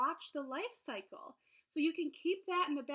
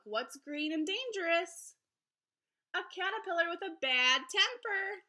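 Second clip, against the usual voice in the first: lively, sing-song picture book read-aloud; reading to children; 6 semitones higher, 7 dB louder than usual. Acted. Teacher reading a butterfly book to her class, lively storybook voice. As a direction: read it brightly to young listeners.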